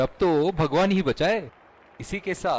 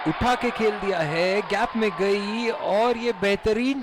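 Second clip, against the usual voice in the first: excited cricket commentary; 5 semitones higher, slower than usual. Acted. Boundary call in live commentary.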